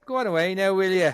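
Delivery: thick Dublin accent, put on; hammed-up Dublin fighter talk; Conor McGregor impression. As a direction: do a punchy, mock-threatening Dublin accent. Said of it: "is Conor McGregor".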